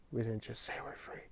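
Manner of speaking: quiet, held-back retort; hushed setup. Acted. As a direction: drop almost to a murmur before the punchline. Quiet setup before shouted declaration.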